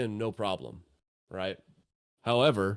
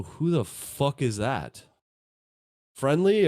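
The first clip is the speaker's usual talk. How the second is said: wary archer; 4 semitones higher, 3 dB louder than usual. Acted. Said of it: "she's gonna go".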